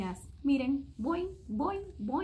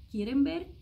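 usual speaking voice

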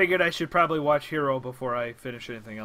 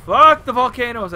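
frustrated; 8 semitones higher, 12 dB louder than usual. Real, not acted. Frustrated at volcanoes in game.

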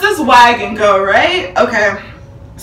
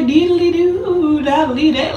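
lightly sung happy nonsense; a hint of a playful kid humming; carefree child singsong; 3 semitones higher, 3 dB quieter, slower than usual. Seven-year-old Mia in the wagon, carefree singsong. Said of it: "baby Mia having fun".

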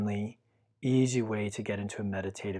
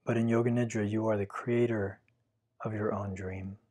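guided meditation. Acted. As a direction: guide the listener gently into stillness. Leads a Yoga Nidra meditation.

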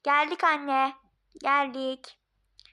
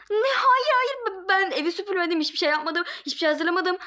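panicked; 5 semitones higher, 4 dB louder than usual. Acted. Panicking wife.

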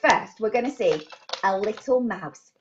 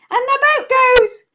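higher, quicker build-up to the noise; excited lead-in; 12 semitones higher, 7 dB louder than usual. Shown as a demonstration. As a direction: rush up high into the toot.

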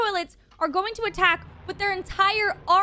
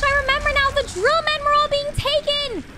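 usual speaking voice

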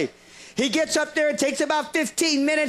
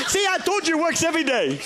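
laughing boast; 4 semitones higher than usual. Retold or imitated.

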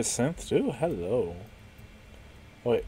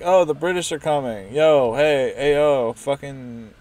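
mock Paul Revere shout; 3 semitones higher, 11 dB louder than usual. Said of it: "He's like".

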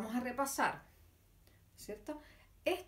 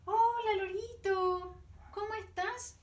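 excited greeting; 8 semitones higher than usual. Acted. Greeting the parrot puppet.

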